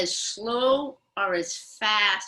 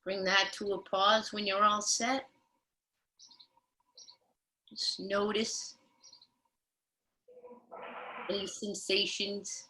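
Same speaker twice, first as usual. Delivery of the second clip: soothing yoga teacher; 7 dB quieter than usual. Acted. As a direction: ease them into stillness. Leads a body awareness pause.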